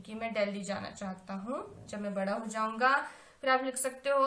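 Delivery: ordinary talk, nothing marked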